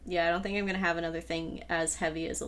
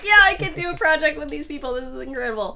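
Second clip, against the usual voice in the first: excited; 7 semitones higher, 9 dB louder, faster than usual. Retold or imitated. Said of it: "and I was like".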